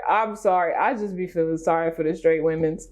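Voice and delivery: usual speaking voice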